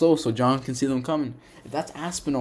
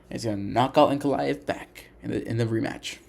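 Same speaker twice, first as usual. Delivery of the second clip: usual speaking voice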